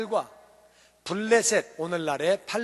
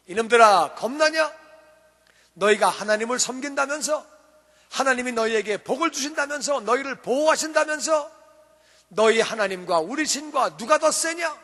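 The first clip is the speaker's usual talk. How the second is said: loud, raised-pitch jeer; 골리앗, jeering; 4 semitones higher, 5 dB louder than usual. Acted. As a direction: hurl mocking challenges at terrified soldiers. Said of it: "막 하나님을 조롱하는 거예요". (큰 소리로 조롱하듯)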